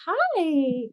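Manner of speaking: high, drawn-out mock-innocent hello; sheepish greeting. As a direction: greet him high, as if nothing happened. Greeting husband after the outburst.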